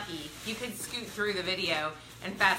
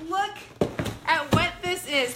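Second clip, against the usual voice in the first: amazed; 6 semitones higher, 5 dB louder, slower than usual. Real, not acted. Revealing the box contents.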